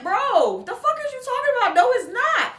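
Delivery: high, loud and fast indignant snap; indignant retort. Retold or imitated. Customer snapping back at the stylist insisting her hair is wet, indignant. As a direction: fire it back in disbelief.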